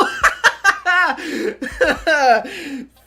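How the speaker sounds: loud, high burst of real laughter; uproarious laughter. Real, not acted. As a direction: crack up completely. Cracks up at search result.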